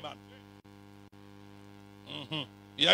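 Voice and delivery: ordinary talk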